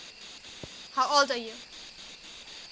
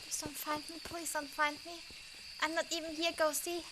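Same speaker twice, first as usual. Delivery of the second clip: whispering while hiding; 3 semitones higher, 10 dB quieter than usual. Real, not acted. Hiding from hunting ghost.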